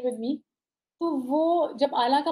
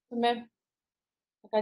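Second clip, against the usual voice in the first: ordinary delivery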